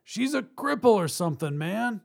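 high, protesting call; calling back. Acted. Robber to partner about hostage, protesting call. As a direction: explain the problem across the room.

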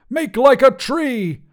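high, loud, drawn-out cry; maniacal scream. A narrator's reading. Wizard unleashing his saved spell, screaming maniacally. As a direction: scream it out slowly, high and crazed. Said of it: "laughed maniacally and screamed".

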